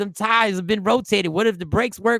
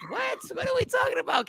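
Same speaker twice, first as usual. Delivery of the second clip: way higher, softer "what?"; shocked squeal; 6 dB quieter than usual. Real, not acted. Host thrown by a crude joke, high surprised squeak. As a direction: squeak in mock disbelief.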